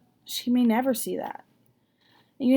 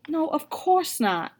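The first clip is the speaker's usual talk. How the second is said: reassuring; 7 semitones higher, slower than usual. A narrator's reading. Mama reassures the kids.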